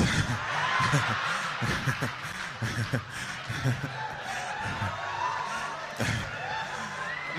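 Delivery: drawn-out laugh tapering off; long wheezing laugh. Real, not acted. Comedian laughs at own joke, long laugh. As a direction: laugh on and on, softening.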